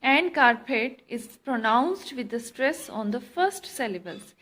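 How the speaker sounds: usual speaking voice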